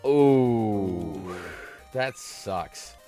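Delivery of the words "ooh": usual speaking voice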